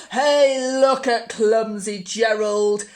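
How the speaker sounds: loud taunting call; mocking jeer. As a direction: jeer at him across the floor. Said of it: "the animals all laughed".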